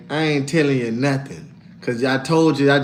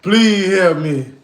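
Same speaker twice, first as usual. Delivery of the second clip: begging for help; much slower than usual. Retold or imitated. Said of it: "I said".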